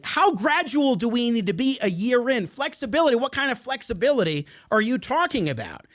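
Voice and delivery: incredulous challenge. Real, not acted. Host challenging the health official's vague promise, incredulous. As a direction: fire back indignant rhetorical questions.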